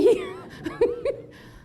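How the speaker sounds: bright high burst of laughter. Real, not acted. Laughing after refusal.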